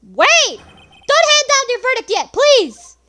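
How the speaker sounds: high, very loud desperate yell; full-force, high-pitched courtroom plea; frantic interrupting shout. Acted. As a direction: shout to halt the verdict. Lawyer interrupts the verdict.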